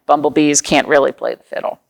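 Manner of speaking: ordinary talk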